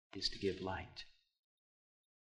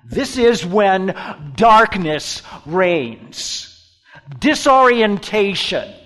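louder, higher, full-force sermon; preaching; 8 semitones higher, 15 dB louder, much slower than usual. Acted. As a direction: drive it home to the pews.

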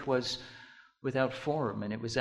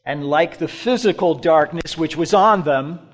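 louder, driving sermon voice; preaching; 5 semitones higher, 11 dB louder than usual. Acted. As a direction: push it out to the congregation.

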